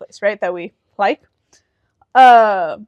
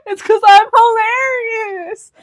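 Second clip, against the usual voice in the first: high, loud, drawn-out brag; playful shouted boast; boastful cry; 9 semitones higher, 4 dB louder, much slower than usual. Real, not acted. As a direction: wail it slowly in comic self-praise. Boasting over friend's teasing.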